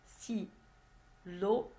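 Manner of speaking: STYLO split into sti, then lo; teacherly "stylo" in two isolated beats; syllable by syllable. Shown as a demonstration. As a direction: break "stylo" in two with a long pause between syllables. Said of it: "deux syllabes".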